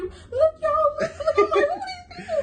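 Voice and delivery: high, loud, crying and laughing; tearful mom. Retold or imitated. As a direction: show everyone through happy tears. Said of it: "She showed me".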